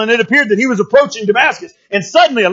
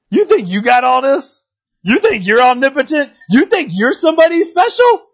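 taunting; 4 semitones higher than usual. Retold or imitated. God belittles the boastful enemy, taunting. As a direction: stretch each question, climbing higher.

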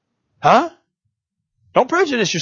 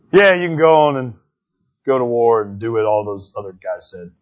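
sarcastic yes; 8 semitones lower, 3 dB quieter than usual. Retold or imitated. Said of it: "He says it just like this".

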